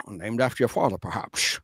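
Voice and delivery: low Scottish purr fading to a breathy hush; Sean Connery impression. Host redoing Sean Connery's quip on request. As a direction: drop low and dry, end almost unvoiced. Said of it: "Do it again".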